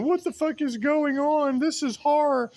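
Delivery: high, loud mock panic; mimicking a panicked man, pitched high and loud. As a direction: ham up his terror. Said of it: "that dude saying".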